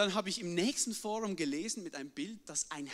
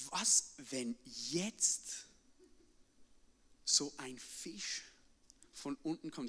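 slow, soft fearful thought; scared inner voice; 7 semitones lower, 5 dB quieter, slower than usual. Retold or imitated. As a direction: drag it out, quietly scared. Alone far out, fearing sharks.

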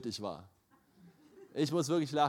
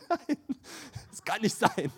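laughing protest; 7 semitones higher than usual. Retold or imitated. Laugh breaking through protest.

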